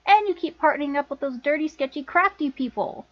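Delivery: disapproving list with falling end; scolding accusation. Acted. Sister scolding Lana over her partners, accusing. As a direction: list them off in reproach, voice falling. Scolding, then echoed back as question.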